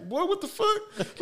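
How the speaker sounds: shocked mom. Retold or imitated. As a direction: mom jolted by her son handing chips.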